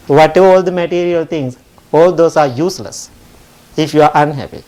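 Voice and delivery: normal talking voice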